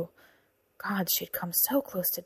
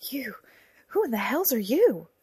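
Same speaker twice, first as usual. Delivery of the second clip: startled, higher and louder demand; bewildered, raised-voice question; startled challenge; 5 semitones higher, 4 dB louder than usual. A narrator's reading. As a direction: demand to know who this stranger is. Startled wounded page challenges her rescuer.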